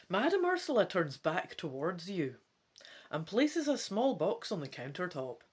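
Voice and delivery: rustige voorleesstem; verhaalverteller. A narrator's reading. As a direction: vertel het verhaal met spanning. Reads gamebook narration.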